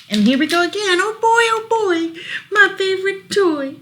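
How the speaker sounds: high, loud, squealing with delight; excited character. Acted. Reading a character's line to child, high excited squeal. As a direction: squeal it like a kid with a toy. Toy-loving character in book.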